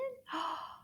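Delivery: soft, high gasp of realisation; a sudden little gasp. Pretend. Puppet gasps as it works out the answer.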